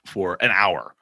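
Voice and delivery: ordinary talk, nothing marked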